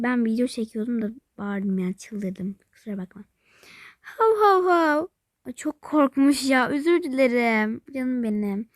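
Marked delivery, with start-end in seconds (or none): 4.0-5.1 slow, higher woof-woof imitation; mock dog bark.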